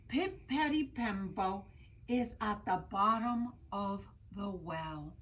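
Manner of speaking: slow, careful, deliberate recital. Acted. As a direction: say each name slowly and respectfully. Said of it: "slowly said".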